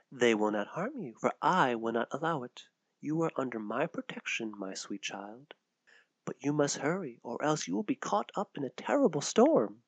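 put-on mysterious hooded woman soothing a child; soft protective woman. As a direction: promise her she's safe, almost under your breath.

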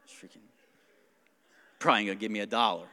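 low, muttered mock complaint; imitating a two-faced server's private gripe; grumbling aside. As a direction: grumble it under your breath, low and quick. Waitress grumbling after walking away.

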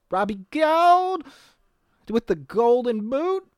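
high, stretched-out name call; drawn-out mock announcement. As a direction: hold the surname high and long. Mock announcing the opposing kicker.

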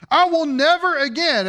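NEVER AGAIN proclaimed high and loud; emphatic. Retold or imitated. Preacher quoting God's vow to the congregation, emphatic. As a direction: drive home "never again" with forceful emphasis. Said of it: "how emphatic the emphasis is".

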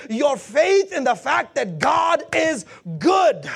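loud, driving pulpit delivery; full-force preaching. Acted. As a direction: preach it with rising urgency.

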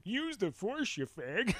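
high put-on voice of a teasing friend; piercing mock advice from beyond; Wayne. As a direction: imitate a ghostly mentor, mockingly high. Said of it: "his voice is gonna come in".

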